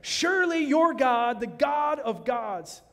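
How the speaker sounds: loud, high, slow royal declaration; the king cries it out before Daniel; king's proclamation. Acted. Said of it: "listen to what King Nebuchadnezzar says".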